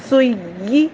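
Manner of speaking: سوئی with a firm doubled ی, stressed and rising. Shown as a demonstration. Teacher demonstrating the doubled ya of سوئی. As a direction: lean hard on the doubled ی in سوئی. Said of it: "یہ sound آئے".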